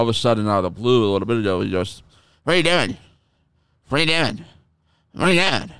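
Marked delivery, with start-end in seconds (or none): 2.4-5.7 higher, mock pestering voice; repeating it like a nagging pest; mocking the friend.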